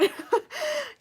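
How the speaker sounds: sudden high laugh; high burst of laughter. Real, not acted. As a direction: giggle breaking out high. Laughing at chat joke.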